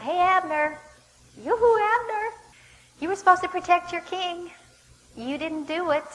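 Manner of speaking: high, loud taunting call; yelling out. Acted. David hurling insults at Abner from the hillside, yelling out. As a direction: holler across the valley, chiding. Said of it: "he starts yelling out".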